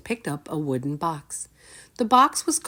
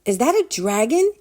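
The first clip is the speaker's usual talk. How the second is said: put-on high, louder boyish amazement; boy asking; 8 semitones higher, 6 dB louder than usual. A narrator's reading. Drake asking the wizard about the dragon. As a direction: ask in wonder. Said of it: "he asked".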